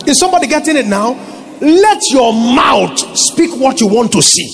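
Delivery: high, forceful pulpit delivery; full-force preaching. Real, not acted. Pastor teaching that thinking rules speech, full-force preaching. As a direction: drive it home to the congregation.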